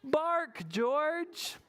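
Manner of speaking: high, sing-out call to a pup; coaxing mother. Shown as a demonstration. Teacher leading children to call to George, high coaxing. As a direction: model it brightly for children to repeat. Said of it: "Can you say that?".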